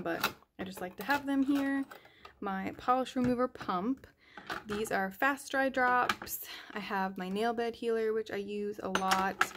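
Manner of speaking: normal talking voice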